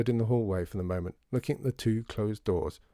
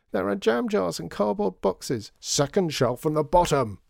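higher, raised voice with insistent directions; shouting; 8 semitones higher, 4 dB louder than usual. A narrator's reading. Shopkeeper correcting child through hatch, shouting. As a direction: holler it across a storeroom. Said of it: "shouted Mister M".

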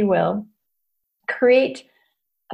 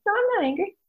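small, much higher, softened protest; high-pitched denial; 10 semitones higher, 4 dB quieter, faster than usual. Retold or imitated. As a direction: re-enact a meek little denial. Acting her own denial to therapist.

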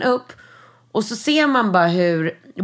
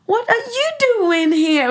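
much higher, slow, light surprised voice; David Beckham's light voice; 12 semitones higher than usual. Retold or imitated. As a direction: imitate a stunned dad, pitched way up. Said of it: "lite ljus röst".

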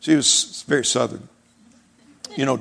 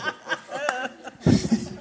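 a high burst of laughter; cracking up mid-story; 10 semitones higher, 5 dB quieter than usual. Real, not acted. Preacher laughing at the squashed bread.

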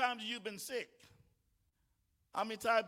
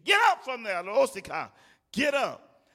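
shouting command; 11 dB louder than usual. Real, not acted. Preacher commands listener to rise.

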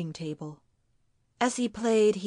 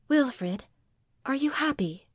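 higher-pitched wife's voice, tentatively asking; a gentle, higher question seeking reassurance; anxious wife; 6 semitones higher than usual. Acted. Wife asks husband if he is happy, anxious.

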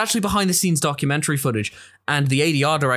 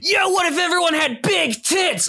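high excited shout; mock bro shout. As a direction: yell it like a hyped bro. Mock Todd McFarlane shout.

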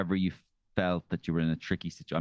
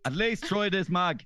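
high, slow dad voice; hammed-up father offering advice; impression of a dad; 12 semitones higher, slower than usual. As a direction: drag it out like a coaxing dad. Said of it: "sound like my dad".

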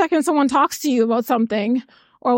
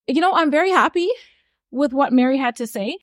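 ordinary talk

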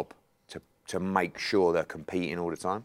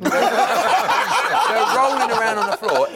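slow words through loud laughter; laughing; 8 dB louder, much slower than usual. Real, not acted. Promoter laughing as he recalls the fighters brawling, laughing.

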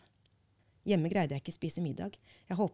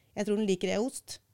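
rushes through «Jeg tror den liker» with a short «æ», higher and louder; a hurried dash to reach «ost»; said very fast; 3 semitones higher, 4 dB louder than usual. Shown as a demonstration. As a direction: rattle it off quickly, barely touching «æ». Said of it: "blir setningen til".

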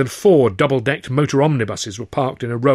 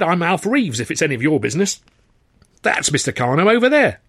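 impatient manager; 6 semitones higher than usual. Acted. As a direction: hurry him along, very impatient.

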